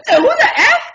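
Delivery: high, loud, laughing disbelief; shocked outburst. Retold or imitated. Clef reacts to her prying question, shocked yell. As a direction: burst out in shocked outrage.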